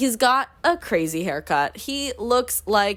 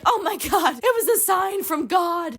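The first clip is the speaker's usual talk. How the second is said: high-pitched retold exclamation; mock amazed outcry; 8 semitones higher than usual. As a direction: act out an astonished cry. Italian woman awed by the sign.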